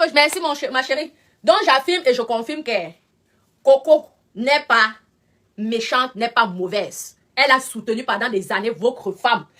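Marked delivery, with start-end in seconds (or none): 3.6-7.2 deliberate insisting defence of a friend; slow emphatic denial. Real, not acted. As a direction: hammer the point home slowly. Affirms Coco is not bad.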